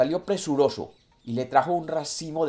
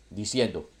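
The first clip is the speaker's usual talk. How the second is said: plain reading voice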